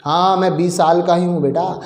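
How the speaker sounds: normal talking voice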